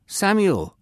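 high, loud call of a name. A narrator's reading. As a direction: call the boy's name loudly. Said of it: "the voice called again".

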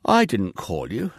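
woken-up, yawning voice; sleepy, yawning reply. A narrator's reading. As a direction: answer through a yawn. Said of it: "Eli replied, yawning".

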